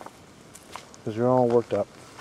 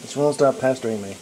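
usual speaking voice

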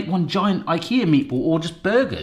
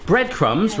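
ordinary talk, nothing marked

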